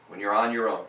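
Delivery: usual speaking voice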